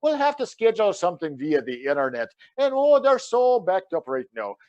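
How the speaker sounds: Lucky Charms commercial Irish; Irish accent. Acted. Counselor explains court delays, Irish accent. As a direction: explain it in a stagey Irish brogue. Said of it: "you sound like the commercial from Lucky Charms".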